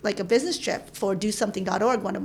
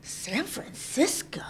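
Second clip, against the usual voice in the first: slow, hushed question rising at the end; drawn-out mock-wondering whisper; playful whisper; 4 semitones higher, 7 dB quieter, slower than usual. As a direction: whisper the city's name like a discovery. Playful aside likened to Goldilocks.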